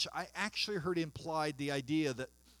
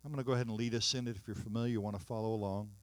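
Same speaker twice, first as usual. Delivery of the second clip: ordinary talk, nothing marked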